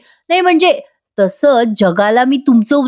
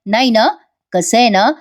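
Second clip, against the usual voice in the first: normal talking voice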